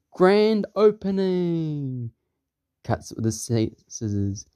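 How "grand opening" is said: high, long-drawn proclamation; hammed-up opening fanfare voice; grand announcer. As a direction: announce it with huge stretched words.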